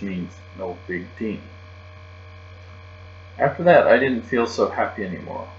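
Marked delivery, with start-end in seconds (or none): none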